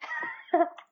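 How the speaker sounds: sudden high laugh; a real laugh breaking out at the question; burst of laughter. Real, not acted. Player laughs when the desk officer asks if he is from Japan, high burst.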